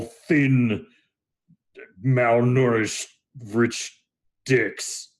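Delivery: ponderous, disdainful Minotaur; scornful Minotaur. Acted. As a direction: list the insults one by one, slowly. Minotaur's scornful reply continues.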